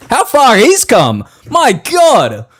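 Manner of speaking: high, loud, slow marvelling; mock awe. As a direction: draw it out in awe. Mock-awed repeat after AI laugh.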